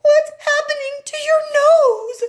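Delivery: very high, slow and loud; a panicked mother staring at her daughter; alarmed mother. A narrator's reading.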